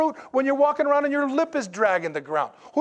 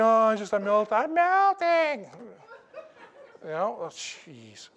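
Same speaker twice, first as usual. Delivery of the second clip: mock melting. Mocking someone melting in heat.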